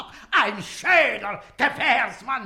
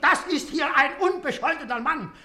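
ordinary talk

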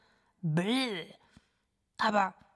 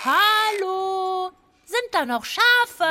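laut und höher hineingerufen; rufend; 9 semitones higher, 5 dB louder, much slower than usual. Acted. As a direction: ruf in den dunklen Stall. Ruft in den Stall hinein. (lauter, gedehnter Ruf)

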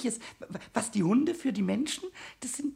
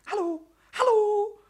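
high, loud singsong hallo; coaxing call; 11 semitones higher, 5 dB louder than usual. Acted. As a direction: lure a little creature out with a high call. Calling the mouse out. (hohes Lockrufen)

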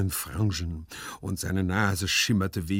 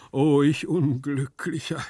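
slow, raised, louder lament; wailing; 6 semitones higher, 6 dB louder than usual. A narrator's reading. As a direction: bemoan your fate theatrically. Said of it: "jammerte Gumpellino". (jammernd, laut und gedehnt)

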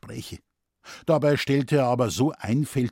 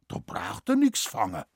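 earnest Bavarian correction, pitched up; dead serious; 5 semitones higher than usual. A narrator's reading. Forester lectures the scholar on catching game, dead serious. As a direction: instruct in dialect without a hint of joking. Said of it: "belehrte Moosbichler todernst".

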